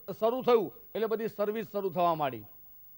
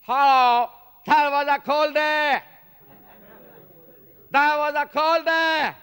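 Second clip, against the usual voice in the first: put-on much higher and louder, slow, with a heavy Gujarati accent, mangled there was a cold day; Gujarati watchman's English; 8 semitones higher, 8 dB louder, much slower than usual. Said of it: "હવે એ લોકોના pronunciation કેવા હોય".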